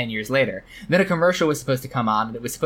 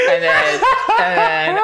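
much higher, louder, words broken by laughter; laughing; 4 dB louder, slower than usual. Real, not acted. Host laughing at the car answer, trying to continue. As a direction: laugh and try to go on.

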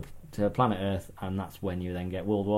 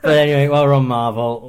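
loud, high, still laughing; pulling himself together through giggles; trying not to laugh; 6 semitones higher, 11 dB louder than usual. Real, not acted. Recovering from laughter.

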